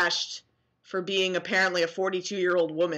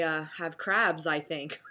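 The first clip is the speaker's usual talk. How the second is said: usual speaking voice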